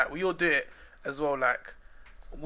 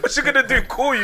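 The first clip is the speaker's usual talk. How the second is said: laughing; 9 semitones higher, 8 dB louder than usual. Real, not acted. Laughing mockery.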